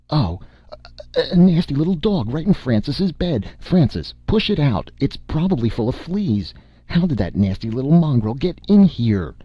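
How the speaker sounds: shrill disgust at a dog in the bed; fussy mother. A narrator's reading. Mother scolding the nurse about the dog in bed, high and disdainful. Narrator voices Mrs Wiley.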